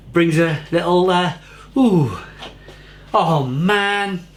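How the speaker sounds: high, moved, fighting back the emotion; getting emotional. Real, not acted. Beer reviewer remembering a brewery dog who died, getting emotional. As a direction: voice rising as feeling wells up. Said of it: "getting all emotional".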